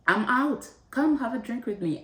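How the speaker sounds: high, bubbly call to come out; best friend calling to invite her for drinks, excited. Retold or imitated.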